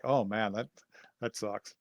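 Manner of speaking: low, very soft mock disappointment; quietly imitated bummed-out reaction; deflated aside. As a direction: say it small and low, like a letdown.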